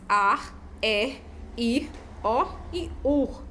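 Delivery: ar, er, ir, o, ur, each said separately; isolated syllables. Shown as a demonstration. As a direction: list ar, er, ir, o, ur with gaps between. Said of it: "trabalhando aqui as sílabas".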